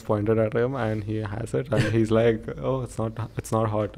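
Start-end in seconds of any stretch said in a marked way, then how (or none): 2.7-4.0 softly insisting, imitating a chilli sufferer; quiet, imitated denial through tears; downplaying the pain. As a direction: play down the burn in a soft mock denial. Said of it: "shaking and tears are coming down".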